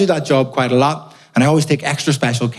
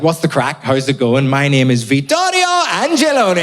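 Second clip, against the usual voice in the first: put-on Italian accent. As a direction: sing the name the Italian way. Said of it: "If I came on stage and was like".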